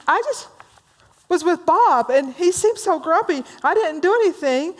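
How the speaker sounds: high, hammed-up wounded whine; upset. Joe tells his supervisor about Bob, upset and hurt. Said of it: "Joe's all upset now".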